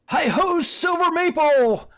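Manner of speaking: high, drawn-out heroic yell; Lone Ranger. Acted. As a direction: holler it like a cowboy calling his horse to ride off. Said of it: "how he would yell".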